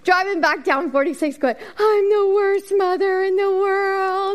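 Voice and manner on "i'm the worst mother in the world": slow, high, drawn-out lament; mock-tragic moan of defeat; despairing wail. Driving home after the disaster.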